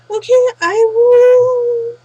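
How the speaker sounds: eager agreement. Acted. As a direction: stretch it out, thrilled to accept. Q agrees to judge dance.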